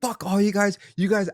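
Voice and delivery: high, heated cursing; angry outburst. Retold or imitated. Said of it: "I went off so bad".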